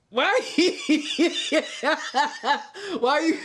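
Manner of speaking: high, breaking into laughter; laughing. Real, not acted. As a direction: talk through a laugh.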